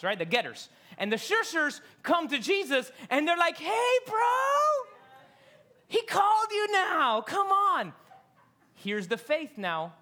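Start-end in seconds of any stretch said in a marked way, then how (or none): 3.6-7.9 high, loud eager hey bro; excited call. Acted. Disciples telling the blind man Jesus called, eager shout. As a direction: wave him over, all hyped.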